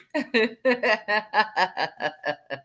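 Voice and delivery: long helpless laughing fit; laughing hard. Real, not acted. Host cracking up after mocking the critics, laughing hard.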